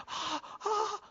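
high, thrilled mock gasping; imitated little squeals of delight; excited gasps. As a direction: gasp like an overjoyed child. Imitating excited child with gift.

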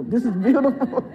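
delighted, laughing through the words. Real, not acted. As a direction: say it as a laugh breaks out. Delighted by the congregation's amens.

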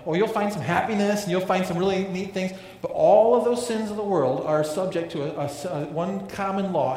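loud, rising preacher's push; preaching loud. Real, not acted. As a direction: drive it home to the congregation. Preaching builds to full force.

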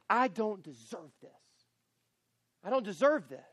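resentful, self-pitying I don't deserve this; bitter complaint. Shown as a demonstration. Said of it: "you say it like this".

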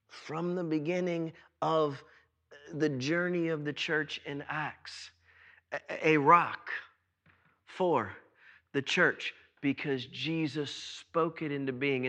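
soft and low, drawn inward; hushed. Real, not acted. Preacher softly tells the congregation of Peter. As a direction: let the voice sink to a hush.

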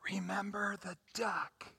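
hushed, menacing stage whisper; conspiratorial whisper. Acted. Sister blackmailing Tommy over the duck, whispered. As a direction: whisper it as a secret reminder. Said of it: "whispers to Tommy".

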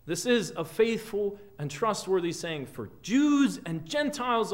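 ringing pulpit delivery in long building lists; impassioned preaching. Real, not acted. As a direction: preach it with force, punching key words. Preaches with rising force.

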